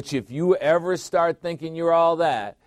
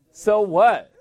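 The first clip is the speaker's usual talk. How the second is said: higher, louder so what; loud challenge; 8 semitones higher, 7 dB louder than usual. Shown as a demonstration. Preacher dismissing his own excuses, loud challenge. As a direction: fling it back as a dare.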